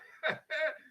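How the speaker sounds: high, loud burst of laughter; real laugh. Real, not acted. Teacher amused at own joke in class, real laugh.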